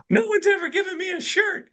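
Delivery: very high falsetto impression; squeaky take-off of a friend; high-voiced mentor. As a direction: mimic his really high voice all through. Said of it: "He's got a really high voice".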